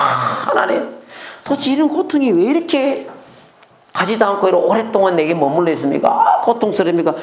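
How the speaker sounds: anguished mock prayer, climbing high; lamenting. Believer in long suffering asking God why, lamenting. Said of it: "그때 막 탄식하는 거예요".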